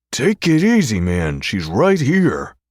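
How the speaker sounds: growl. Acted. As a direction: push back hard, half warning. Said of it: "he growls".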